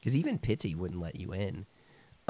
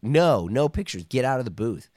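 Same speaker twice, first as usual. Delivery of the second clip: mock stern shout; 4 semitones higher, 6 dB louder than usual. Vendors shouting at bloggers.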